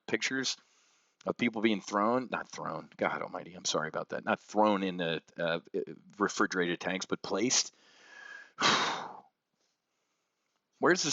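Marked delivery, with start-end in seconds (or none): 2.3-4.2 lower, softer self-correction; chastened aside, quietly said; hushed apology. Real, not acted. Corrects himself, apologizes for wording. 8.6-9.1 wordless breathy sigh; voiceless exhale; heavy sigh. Real, not acted. Pause after describing bodies.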